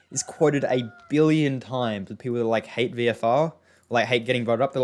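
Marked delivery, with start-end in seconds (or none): none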